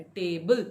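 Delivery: "Table" with the stress on opening टे. Shown as a demonstration. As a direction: lean on टे in "table", let बल fall away. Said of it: "stress है वो टे के साथ".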